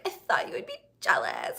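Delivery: high, whispery mock of her sister; a hint of a mocking sister voice. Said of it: "my sister was like".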